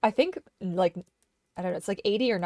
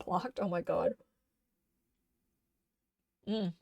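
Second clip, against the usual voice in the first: usual speaking voice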